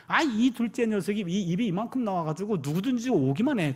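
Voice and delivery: ordinary talk, nothing marked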